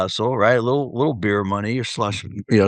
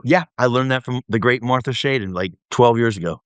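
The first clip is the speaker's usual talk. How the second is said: normal talking voice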